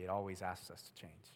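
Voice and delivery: ordinary delivery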